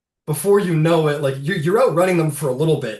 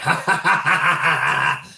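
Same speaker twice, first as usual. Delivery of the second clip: mocking hobgoblin cackle, a bit higher; 3 semitones higher, 4 dB louder than usual. Hobgoblin companion cackling at the encirclement, mock cackle. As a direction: cackle it out like a gleeful goblin. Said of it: "like, cackling".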